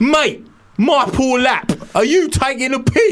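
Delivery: wailing, high and loud Cockney protest; hammed-up Cockney indignation. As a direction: stretch it out as a wounded Cockney. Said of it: "Can we do your Cockney?".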